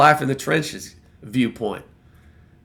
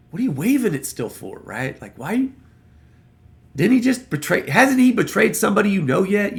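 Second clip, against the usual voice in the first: incredulous inner question; 7 semitones higher than usual. Retold or imitated. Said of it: "You're wondering, like".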